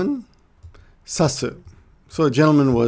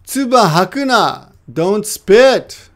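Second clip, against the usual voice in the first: loud, raised-pitch order; forceful command; 6 semitones higher, 7 dB louder, slower than usual. Acted. Teacher acting out the quoted don't spit, forceful command. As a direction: order someone sternly to stop.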